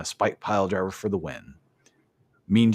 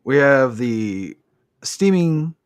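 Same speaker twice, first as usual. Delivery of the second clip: hammed-up ring announcer, higher and louder; mock announcer; 3 semitones higher, 4 dB louder than usual. Host on the podcast announcing the Piper's Pit segment, mock announcer voice. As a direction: introduce the next segment like a showman.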